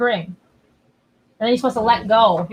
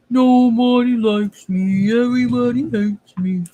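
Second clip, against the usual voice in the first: slow whiny mock lament; hammed-up pity party; mock self-pity; slower than usual. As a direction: whine it like a sulking kid. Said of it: "Just kidding".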